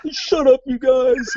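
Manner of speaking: high, loud tearful plea; hammed-up crying kid's outburst; mock crying. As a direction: wail it like a scared kid begging them to stop. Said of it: "He's, like, crying".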